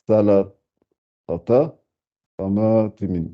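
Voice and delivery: "salatata, sama timin" drawn out slowly; very slow reading. Shown as a demonstration. Teacher rereading the phrase for the class, slow. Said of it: "faut bien, bien articuler".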